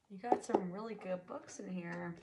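normal talking voice

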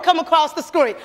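ordinary talk

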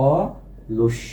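অলস slowly, অ held apart from লস; split into syllables. Shown as a demonstration. Teacher reviews the word for students, অলস slowly split as অ... লস. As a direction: hold the অ of অলস, pause, finish with লস. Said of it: "দুইটা অক্ষরে আমি ভেঙে দিলাম".

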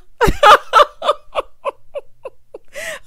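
long, loud high-pitched cackle; hysterical laugh. Real, not acted. Cracking up at a proposal by text, hysterical laughing.

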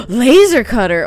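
gasp, then cry out high and loud; excited shout on spotting treasure; real burst of delighted surprise; thrilled discovery. Real, not acted. Finds a wanted item.

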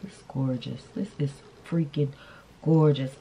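ordinary talk, nothing marked

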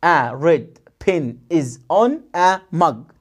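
very slow, word by word, falling end; slow drill again. Shown as a demonstration. Teacher repeating slowly so pupils follow. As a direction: stretch every word. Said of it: "تاني".

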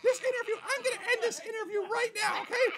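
high-pitched huffy diva. Acted. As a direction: cut the interview short in a high star voice.

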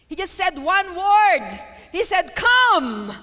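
high, loud, drawn-out proclamation; forceful preaching. Real, not acted. Preacher stressing Jesus' single word, preaching at full force. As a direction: hammer each phrase, landing loud on the last word.

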